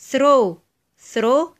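"throw" falling, with breathed th; voiceless TH. Shown as a demonstration. Teacher gives examples of the rule, "throw" with voiceless th. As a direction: model "throw" twice, separately, voiceless th. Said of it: "زي ما احنا شفنا".

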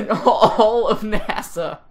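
slow words broken by laughter; laughing. Real, not acted. Host cracking up while reading the article, laughing. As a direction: read it through giggles.

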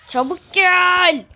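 slow, loud calling out; loud drawn-out call. Real, not acted. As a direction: call them over, stretching it. Calling someone over in game.

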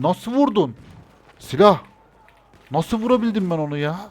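can't believe the shot landed; disbelieving. Real, not acted. Player marvelling at his own lucky hit, disbelieving.

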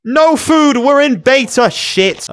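loud, high exasperated outburst; frustrated shout. Real, not acted. Player realizing sheep drop no food, frustrated shout. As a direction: yell your disappointment.

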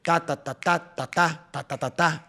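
"ta" syllables in a varied drum pattern; percussive, syncopated run of "ta"; playful rhythm demo. Shown as a demonstration. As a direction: punch the first "ta" of each group. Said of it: "a veces juego de".

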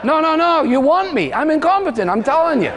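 high, loud insistent protest; hammed-up high-pitched insistence; mock protest. Preacher protests his incompetence.

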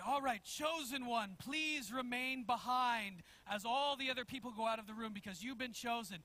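normal talking voice

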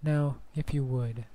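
soft hushed invitation; ASMR whisper. Shown as a demonstration. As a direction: coax gently, ASMR style. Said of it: "ASMR invitation to imagine".

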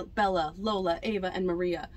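usual speaking voice

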